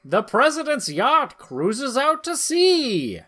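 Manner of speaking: hammed-up narrator; story narration. A narrator's reading. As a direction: read it like a serial announcer. Narrates comic panels.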